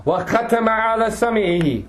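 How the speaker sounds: fast, chanted Quranic recitation set apart from his talk; tajweed recitation. Acted. Teacher reciting the verse before explaining it, in a chanted tajweed voice.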